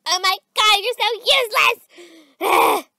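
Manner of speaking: loud, cutting scolding; exasperated outburst. Acted. To the friend who failed to lift her, loud exasperation. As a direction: berate her loudly, word by word. Berating friend after being dropped.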